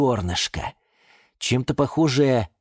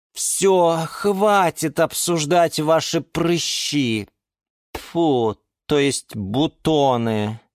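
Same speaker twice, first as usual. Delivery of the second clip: slow, higher-voiced exasperation; indignant; 7 semitones higher, much slower than usual. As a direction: cut off the silly discussion. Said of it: "возмутилась Лиана Диана". (возмущённо)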